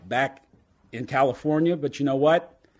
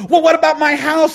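put-on much higher, louder panicked drunk; backpedaling drunk; 14 semitones higher, 10 dB louder than usual. Drunk trying to back out of the deal, high and alarmed. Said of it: "He said".

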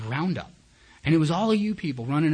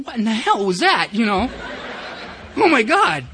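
loud, high-pitched mock shock; startled disbelief; 9 semitones higher, 8 dB louder than usual. Newcomer reacting to the roaring crowd, loud startled disbelief. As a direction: exclaim it as if blasted by the noise.

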